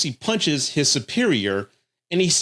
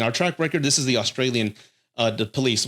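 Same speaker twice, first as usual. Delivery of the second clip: usual speaking voice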